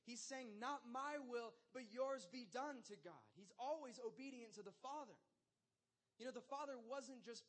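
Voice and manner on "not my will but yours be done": higher, louder and slow surrender; a sincere plea to God; earnest prayer. Retold or imitated. Jesus praying in Gethsemane.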